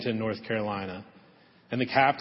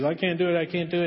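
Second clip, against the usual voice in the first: higher, louder, a touch of mock crying; retold tearful plea; 7 semitones higher, 4 dB louder, faster than usual. Ashamed Jack pleads with his captain, mildly tearful and higher. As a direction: lightly voice a tearful young man who can't go on. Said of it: "And he's crying, and he's in tears".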